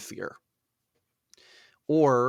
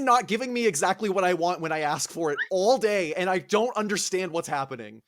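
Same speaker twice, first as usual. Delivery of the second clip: higher, petulant complaint; whiny Achilles; 6 semitones higher than usual. Retold or imitated. Achilles whines to his soldiers in a higher, petulant voice. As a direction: whine like a spoiled hero. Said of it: "And Achilles is like".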